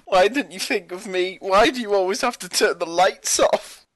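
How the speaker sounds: put-on high complaining girlfriend voice; whiny robot girlfriend. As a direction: whine like a neglected partner.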